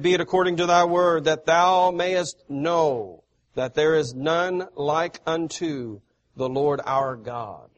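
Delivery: loud, declaiming preacher voice, easing off at the end; forceful scripture reading. Acted. Preacher reading God's words to the congregation, loud and declaiming. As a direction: proclaim God's words from the pulpit. Reads Moses' reply aloud.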